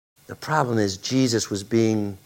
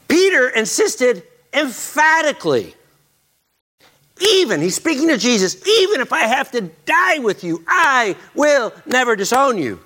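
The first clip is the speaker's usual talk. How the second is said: bold vow; 12 semitones higher, 4 dB louder than usual. Acted. Preacher acting Peter's bold vow.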